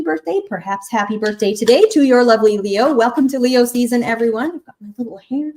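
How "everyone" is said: ordinary delivery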